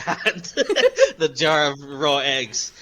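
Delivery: ordinary talk, nothing marked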